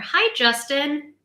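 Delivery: usual speaking voice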